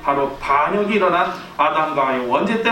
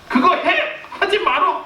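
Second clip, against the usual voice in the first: sharp, higher mock yell of orders; parent yelling; 10 semitones higher than usual. Fed-up parent yelling orders at a clever daughter. Parent loses argument, yells.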